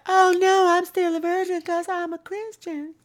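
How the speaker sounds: very high, breathy falsetto giggle. Retold or imitated. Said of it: "sounds like [name]".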